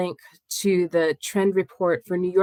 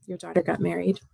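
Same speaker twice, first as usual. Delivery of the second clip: ordinary talk, nothing marked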